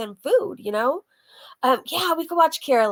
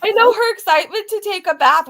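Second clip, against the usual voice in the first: laughing; 8 semitones higher, 7 dB louder than usual. Real, not acted. Laughing about the bath.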